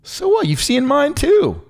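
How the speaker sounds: high and loud, ranting at his cohost; mock-furious rant. Real, not acted. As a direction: yell it in half-joking outrage. Arguing podcast cohost ranting at partner.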